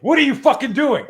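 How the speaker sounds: high, loud outraged yell; indignant shout. Acted. Protector confronts men grabbing the niece, outraged shout. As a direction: snap into full-volume protective outrage. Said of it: "that's indignation".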